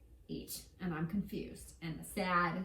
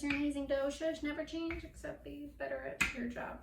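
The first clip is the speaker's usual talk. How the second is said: ordinary talk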